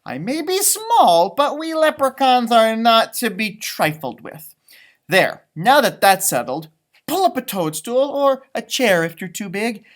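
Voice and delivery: lively storybook leprechaun, bright and bold. Acted. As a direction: read as a proud little leprechaun. Reads leprechaun story in character.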